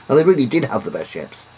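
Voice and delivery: ordinary talk, nothing marked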